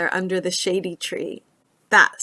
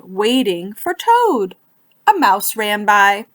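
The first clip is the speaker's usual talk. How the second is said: lively storybook narration, rising higher as the story heats up; reading to children; 5 semitones higher, 4 dB louder than usual. A narrator's reading. Librarian reading Frog and Toad to young listeners, animated storybook voice. Reads picture book to children.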